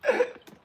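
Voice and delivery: short, loud shriek of laughter; helpless high-pitched laugh. Real, not acted. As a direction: burst out in a high cackle. Cracks up after impression.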